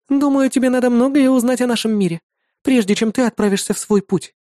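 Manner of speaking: put-on high bright girlish voice; young woman voice. A narrator's reading. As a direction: voice the demon girl cheerfully. Irda explains the world.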